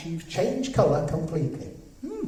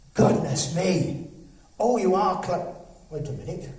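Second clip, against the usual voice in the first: ordinary talk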